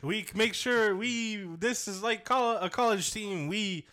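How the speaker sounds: hammed-up Canadian interview voice; mock Canadian accent. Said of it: "it was so Canadian".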